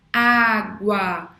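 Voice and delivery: Água loud, with the A after the U standing out; Água said loudly with a clear final A; demo word. Shown as a demonstration. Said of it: "Você escuta mais o U ou o A?".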